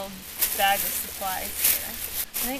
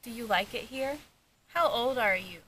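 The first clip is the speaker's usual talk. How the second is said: talking to a ghost; 3 semitones higher than usual. Real, not acted. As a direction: ask the ghost gently. EVP session questions.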